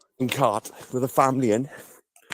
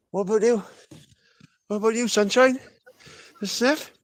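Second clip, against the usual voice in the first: pet talk; 9 semitones higher than usual. Real, not acted. Cooing to a donkey.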